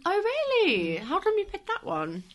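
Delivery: suddenly surprised. Real, not acted. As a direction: pitch jumps up in surprise. Surprised at the news.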